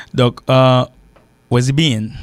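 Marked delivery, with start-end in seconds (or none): 1.5-2.1 "Where's he been" as one run, "wuh-zee-been"; liaison. Shown as a demonstration. Teacher demonstrating liaison, "Where's he been" linked. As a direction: link "Where's he been", dropping the H. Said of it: "il y a la liaison".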